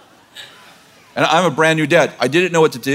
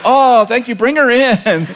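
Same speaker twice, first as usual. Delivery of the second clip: high neighbor mother; 9 semitones higher, slower than usual. Retold or imitated. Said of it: "She's like".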